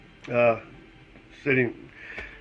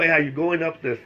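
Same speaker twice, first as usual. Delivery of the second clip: ordinary delivery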